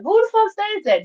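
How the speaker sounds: high, loud disbelief; who says that, in shock; incredulous. Real, not acted. Reacting to a quoted excuse, a high loud incredulous cry.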